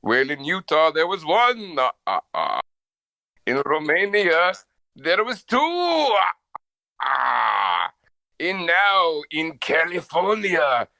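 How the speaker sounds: hammed-up vague Euro accent, slow; general European accent. Answering the friend, a mock Euro count accent. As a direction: lean toward an Eastern Bloc voice. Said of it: "That's my just general European accent".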